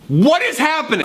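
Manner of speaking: high-pitched, incredulous cry; what on earth is going on; baffled, jumping high in pitch; bewildered outburst. Acted. Exasperated outburst at baffling tangent.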